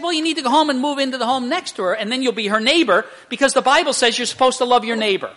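loud, high and rapid retold advice; acted insistent counsel. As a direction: play a counselor driving the point home.

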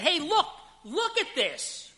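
very high, drawn-out urgent call. Shown as a demonstration. Preacher showing what behold really means, urgent call. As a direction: make them stop and look right now. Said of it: "this is perceive and understand".

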